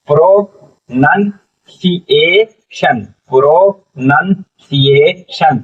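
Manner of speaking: "Pro नन सी ए शन" slowly, each syllable separate. Shown as a demonstration. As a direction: break "pro नन सी ए शन" into drawn-out teaching chunks. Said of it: "क्या पढ़ा जाएगा?". ("Pro नन सी ए शन" एक-एक टुकड़ा अलग करके, धीरे)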